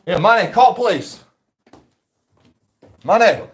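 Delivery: loud high call for help. Real, not acted. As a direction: shout across the house for help. Calling for help from upstairs.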